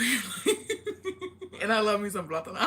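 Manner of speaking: talking through real laughter; laughing. Real, not acted. Cracking up at own joke.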